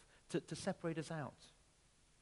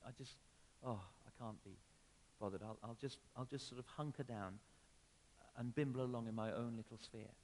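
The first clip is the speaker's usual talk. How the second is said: resigned passivity; 6 semitones lower than usual. Acted. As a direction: give up with a weary "oh". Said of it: "slip back into passivity".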